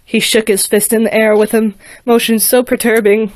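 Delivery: lively rhyming storybook reading; story narration. A narrator's reading. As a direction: read it like a bedtime rhyme. Rhyming story read aloud.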